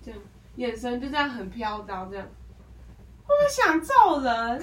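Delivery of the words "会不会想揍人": loud, slow exasperated outburst. Real, not acted. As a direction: let the frustration burst out. Exasperated outburst about customer.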